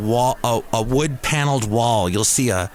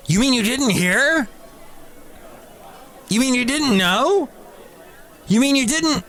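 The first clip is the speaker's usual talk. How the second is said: high-pitched, exaggerated disbelief, rising higher; mock incredulous; 10 semitones higher than usual. Mimicking people shocked he didn't hear, mock incredulous. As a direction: squeal it like an appalled know-it-all.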